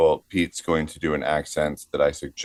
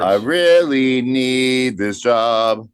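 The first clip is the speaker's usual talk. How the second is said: mock desperate plea; 5 semitones higher, 4 dB louder, much slower than usual.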